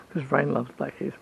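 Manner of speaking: ordinary delivery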